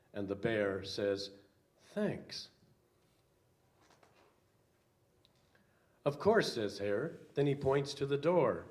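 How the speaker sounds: put-on lively read-aloud for children; storybook reading. A narrator's reading. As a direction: read it like a picture book to kids. Pastor reads children's picture book.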